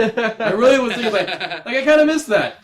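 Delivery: laughing. Real, not acted. Laughing while recounting.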